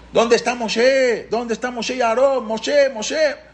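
high, drawn-out cry through the streets; retold calling out. Panicked Paró searching the streets for Moshe, high retold calling out. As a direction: call out as if searching, high-pitched. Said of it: "iba gritando".

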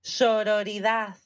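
"Sororidad" spelled out slowly, syllable by syllable; teaching "Sororidad" slowly to a friend; slow careful pronunciation. Shown as a demonstration. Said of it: "Muy bien".

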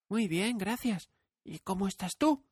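put-on a sweet, polite child asking back; polite little girl. A narrator's reading. Dorothy politely returns the scarecrow's question.

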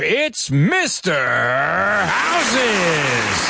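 high, stretched game-show reveal; winner announcement. Pretend. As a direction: draw out the name for the big reveal.

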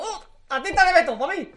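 high, loud, greedy little cry of delight; snatch the find for yourself, thrilled; gleeful exclamation. Retold or imitated. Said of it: "He abierto el cajón y digo". (pillándolo con alegría exagerada)

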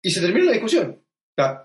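ordinary talk

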